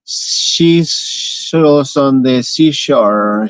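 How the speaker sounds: drawn out very slowly, word by word; slow careful try. Shown as a demonstration. Learner focusing on each word, drawn out slowly. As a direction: stretch each word deliberately. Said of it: "Really focusing on each word".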